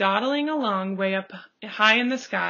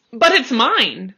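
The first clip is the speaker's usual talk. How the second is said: slow, loud possessive protest; screamed; 2 semitones higher, 9 dB louder than usual. A narrator's reading. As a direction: claim the egg loudly. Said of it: "screamed the bird".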